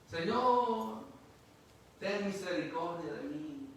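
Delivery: loud, high plea for mercy; pleading cry. Acted. El necesitado suplica misericordia a Jesús, clamando fuerte. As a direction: beg the Lord out loud.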